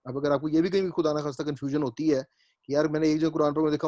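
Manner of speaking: ordinary talk, nothing marked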